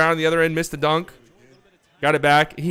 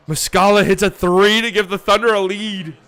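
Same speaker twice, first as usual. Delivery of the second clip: louder, higher go-ahead call; excited call; 4 semitones higher, 5 dB louder than usual. Real, not acted. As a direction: sell the lead change. Calling the go-ahead three.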